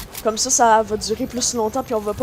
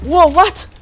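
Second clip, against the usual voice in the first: shocked loud yelp, much higher; stunned at the recoil; surprised; 7 semitones higher, 10 dB louder than usual. Real, not acted. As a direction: blurt it in disbelief. New gun's huge recoil.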